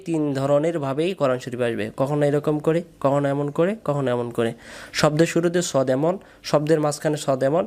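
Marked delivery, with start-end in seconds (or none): none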